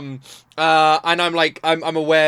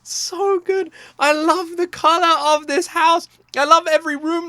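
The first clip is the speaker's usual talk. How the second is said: gushing excitement; 14 semitones higher, 4 dB louder than usual. Real, not acted. Gushing over the video.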